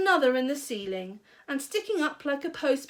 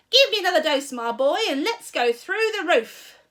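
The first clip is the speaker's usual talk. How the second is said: louder, higher demanding call; screechy old grandma; 6 semitones higher, 8 dB louder than usual. A narrator's reading. Grandma calling down for another dose. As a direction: demand it like a crazed crone.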